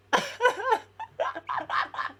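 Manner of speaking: high-pitched burst of laughter; squealing laugh. Real, not acted. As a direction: crack up at the memory. Laughing at the memory.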